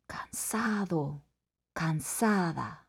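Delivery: slow, soft tired whisper; sleepy whisper. Shown as a demonstration. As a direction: breathe it out half asleep. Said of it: "go to sleep".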